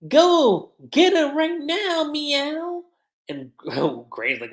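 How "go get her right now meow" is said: high, slow, cute catlike demand; high kitty voice drawn out on meow; Wendy voice. Retold or imitated. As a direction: coo it like a little cat girl giving orders. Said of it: "Wendy's like".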